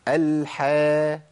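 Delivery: slow "الحا" with a thin front vowel; held light vowel in "الحا"; correct light ha. Shown as a demonstration. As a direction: stretch "الحا" lightly, like "hae". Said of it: "لكن عندنا في القران حا".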